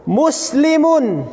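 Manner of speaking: Muslimun, slow, with mu held; long mu. Shown as a demonstration. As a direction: stretch the vowel in Muslimun.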